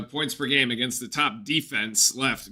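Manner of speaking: ordinary delivery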